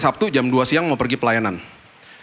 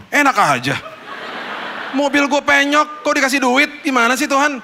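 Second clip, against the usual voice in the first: indignant protest; 10 semitones higher than usual. Retold or imitated. Protesting to God about money.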